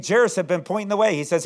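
usual speaking voice

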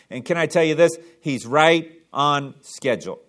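normal talking voice

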